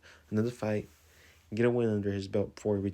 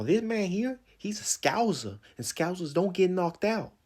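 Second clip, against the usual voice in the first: put-on terrible Scouse accent, pitched up; Liverpool accent; 9 semitones higher, 4 dB louder than usual. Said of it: "Excuse my Liverpool accent, it's terrible".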